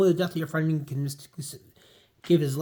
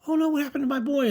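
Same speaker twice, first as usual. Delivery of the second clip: put-on much higher, fast fretful old aunt; worried old lady; 11 semitones higher, faster than usual. Aunt May asks about Peter, high fretful old lady. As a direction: flutter like a frightened aunt.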